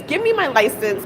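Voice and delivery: normal talking voice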